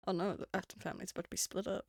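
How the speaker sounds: ordinary talk, nothing marked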